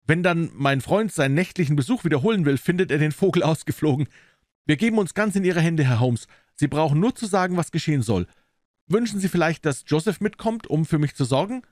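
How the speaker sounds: high-pitched client voice; story figure of eager client. Acted. Client defers to the detective, high character voice. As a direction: put yourself in his hands, pitched high.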